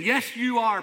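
high, loud mock agreement; imitated congregation retort. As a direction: answer back as an emphatic congregation. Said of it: "As if they were saying".

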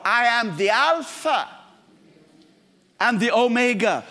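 solemn proclamation. Acted. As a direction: announce it with majesty. Proclaiming Christ's words.

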